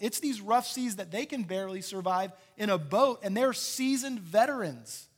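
loud, high, full-force sermon delivery; impassioned preaching. Real, not acted. Preacher tells the congregation Peter walked on water, impassioned. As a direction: drive each point home to the crowd.

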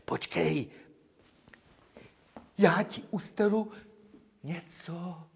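high, far-off sounding coaxing of a dog; sing-song bribing of a lapdog. Acted.

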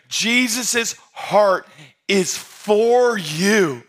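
each word stretched out very slowly; slow and deliberate. Shown as a demonstration. Pastor giving the congregation a line for their notes, very slow. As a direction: dictate it like a note to copy down. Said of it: "I wrote it down in your notes this way".

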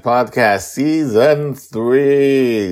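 big, high, loud announcer welcome; podcast intro. Acted. Host greeting listeners to the podcast, bright announcer style. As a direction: open the show like a host.

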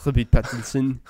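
put-on playful French accent, pushed up in pitch and volume. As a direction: put the name through a mock French accent. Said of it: "say names with a French accent".

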